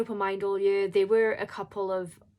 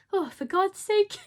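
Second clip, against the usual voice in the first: tearful exasperation; 11 semitones higher, 6 dB louder than usual. Real, not acted. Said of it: "I'm quite an over-emotional person".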